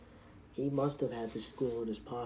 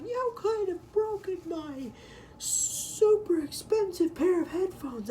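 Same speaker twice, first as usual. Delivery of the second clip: slow, very high, exaggerated outrage; mock yelling; 6 dB louder, slower than usual. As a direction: drag out the complaint in a mocking high voice. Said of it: "He's, like, yelling at me".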